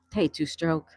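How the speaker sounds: ordinary talk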